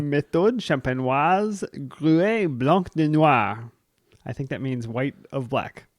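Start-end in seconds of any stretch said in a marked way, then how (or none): 0.0-3.7 slow, careful French accent. Shown as a demonstration. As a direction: read the wine name in a French accent. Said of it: "How was my French accent?".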